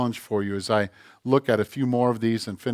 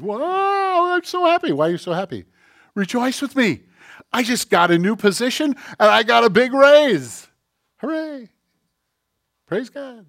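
much higher, gushing coworker voice; gloating coworker; 9 semitones higher, 5 dB louder than usual. Retold or imitated. Coworker bragging about his raise and new position, high and gushing.

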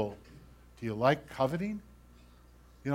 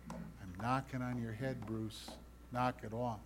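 quiet scolding; 3 semitones lower, 13 dB quieter than usual. Acted. God scolding the preacher, voiced.

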